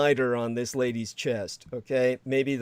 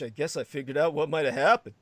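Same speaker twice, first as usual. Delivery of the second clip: ordinary talk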